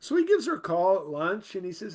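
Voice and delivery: ordinary talk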